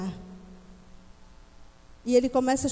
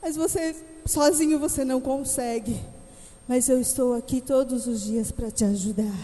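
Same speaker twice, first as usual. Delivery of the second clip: tender father; 6 dB louder than usual. Acted. Father reassuring his son on the slide, tender. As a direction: soothe the child gently.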